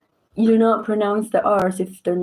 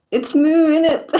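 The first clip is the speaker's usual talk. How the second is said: loud, high mock British slang; hammed-up British accent, pitched up and punched out; British cow accent; 7 semitones higher, 5 dB louder than usual. Repeating the meme's British cow, loud mock British accent. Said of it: "four British cows like".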